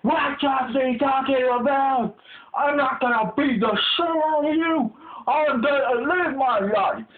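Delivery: high, hollered motivational declarations; amped-up gym bro. Acted. Gym bro promising to make others stronger, fervently hyped. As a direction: declare each promise with pumped-up drive.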